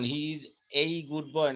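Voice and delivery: put-on slow, careful he is a good boy, the article first as "ei" then as "uh"; teacher drilling the sentence slowly; article said two ways. Shown as a demonstration. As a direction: contrast the two readings of the article. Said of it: "আর্টিকেলের বেলায় কি হবে".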